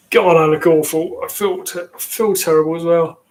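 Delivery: ordinary talk, nothing marked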